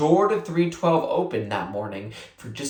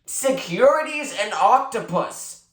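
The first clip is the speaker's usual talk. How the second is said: very clearly; 7 semitones higher than usual. A narrator's reading. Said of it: "Marshall said very clearly".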